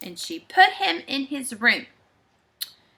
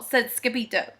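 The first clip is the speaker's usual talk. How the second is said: usual speaking voice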